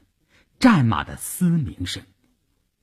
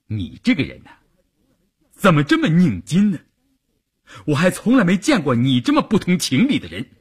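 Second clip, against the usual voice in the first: higher-pitched, heated complaint building after a held-back start; exasperated scolding; 6 semitones higher, faster than usual. A narrator's reading. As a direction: scold a stubborn companion in rising frustration. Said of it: "吴进水后来真的发火了". (又气又急地数落)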